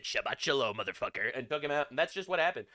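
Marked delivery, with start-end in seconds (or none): 0.0-1.3 lower, softer deadpan killer line; tough agent one-liner. Retold or imitated. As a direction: growl it low like an action hero.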